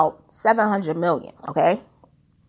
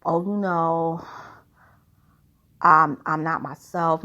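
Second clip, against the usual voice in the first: slow, lower, limp excuse voice; mock hollow apology; 6 semitones lower than usual. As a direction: drag out a lame apology, lightly mocking. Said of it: "He would just, you know, say like".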